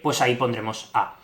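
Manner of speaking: ordinary talk, nothing marked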